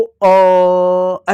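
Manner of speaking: "अ" held long and steady; lingering अ sound. Shown as a demonstration. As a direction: sustain "अ" like the tail of a stretched क. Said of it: "ऐसा अ सुनाई दिया होगा".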